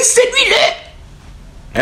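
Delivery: stretched very high and very slow; drawn-out insistence. Retold or imitated. Narrator insisting to his girlfriend, stretched high and slow. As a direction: hold every sound of the order, way up high.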